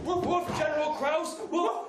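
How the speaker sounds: loud dog barks around a name; loud barking. Pretend. Dog loudly barks at General Kraus. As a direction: bark it out at full volume.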